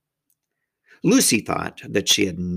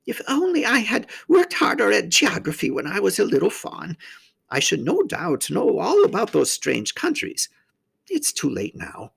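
high faun voice with wistful regret; rather melancholy; 10 semitones higher than usual. A narrator's reading. Faun regretting his poor geography, mildly melancholy. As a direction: lament mildly, pitched up, sinking at the end. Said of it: "in a rather melancholy voice".

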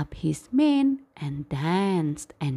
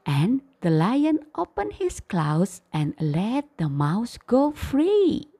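usual speaking voice

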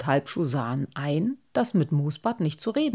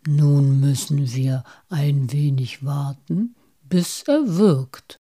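slow, breathy character voice; measured, drawn-out pronouncement; Moosbart voice; 4 semitones lower, 5 dB louder than usual. A narrator's reading. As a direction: instruct the friends patiently and slowly.

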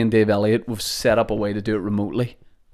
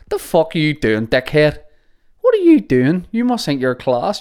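higher-pitched incredulous heckle; mock indignant mate; 5 semitones higher, 3 dB louder than usual. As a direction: needle your mate for showing off. Mate heckling him over sunglasses.

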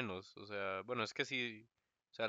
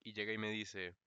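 ordinary talk, nothing marked

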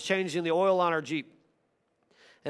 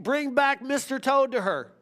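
normal talking voice

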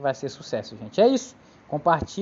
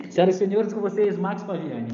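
grand entrance announcement; 4 semitones higher than usual. Acted. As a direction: announce the guest to the crowd. Host brings in the guest.

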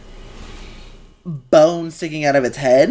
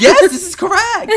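excited laughing yes; 5 dB louder than usual. Real, not acted. Emphatic agreement.

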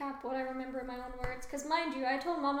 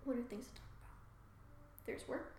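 mumbling to oneself; 7 dB quieter, faster than usual. Real, not acted. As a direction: mumble privately while searching for ideas. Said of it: "Mumbling to myself".

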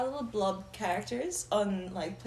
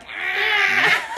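yawn scream; 9 semitones higher, 9 dB louder than usual. Retold or imitated. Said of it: "My yawn's like".

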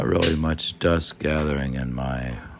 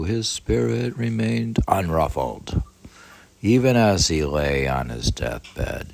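solemn reading aloud; 7 dB louder than usual. Acted. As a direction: lift the voice and read it solemnly. Reads the biography aloud.

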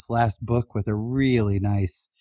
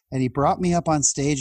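ordinary talk

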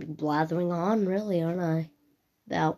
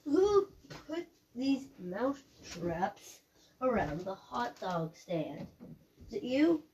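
mumbled aside; 11 dB quieter than usual. Pretend. Talking to hot dog vendor.

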